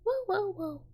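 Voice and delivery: high, falling cat-like squeals; high voiced kitty cries, sliding down; little cat noises. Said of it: "I love a fake little cat noise".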